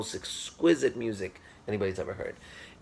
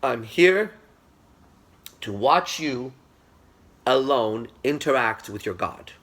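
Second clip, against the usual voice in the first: solemn emphatic quote; 3 semitones higher, 5 dB louder, slower than usual. Retold or imitated. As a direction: deliver the mentor's credo with weight. Quoting Manu, continued.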